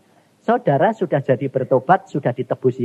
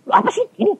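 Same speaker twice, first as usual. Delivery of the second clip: much higher, puzzled question; his own surprised voice; 3 dB louder, faster than usual. Retold or imitated. The preacher retelling his own puzzled question to God, much higher. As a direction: play yourself baffled at the gift. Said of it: "dikasih sertifikat".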